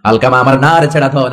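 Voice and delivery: normal talking voice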